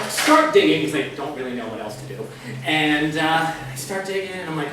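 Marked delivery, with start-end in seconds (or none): none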